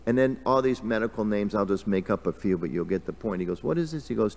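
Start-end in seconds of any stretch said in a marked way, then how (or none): 3.6-4.1 high, playful questioning; Krishna. Retold or imitated. Playful god keeps quizzing the sick man, high and curious. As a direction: ask it lightly, pitched well up. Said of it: "Krishna's playful, Krishna does".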